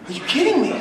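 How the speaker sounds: incredulous. Retold or imitated. Disbelief at producer's line.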